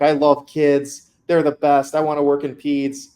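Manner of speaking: put-on happy, slow, gushing about the kids; gushing about loving kids in peds; imitating eager student. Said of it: "You're like".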